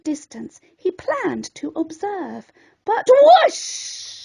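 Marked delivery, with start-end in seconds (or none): 2.8-4.2 high, swept-up whoosh; dramatic whoosh. A narrator's reading. Narrator sweeping the net in with a whoosh. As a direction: sell the rush of the net.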